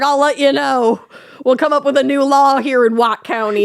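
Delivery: lightly voiced Southern lawman, unhurried; Southern sheriff. Retelling the sheriff's statement in a light Southern accent. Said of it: "they're suddenly Southern".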